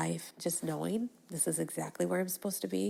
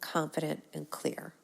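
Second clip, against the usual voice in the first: ordinary talk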